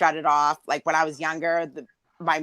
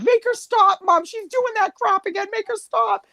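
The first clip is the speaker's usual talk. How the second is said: whiny brother. Retold or imitated. Brother complaining to mom.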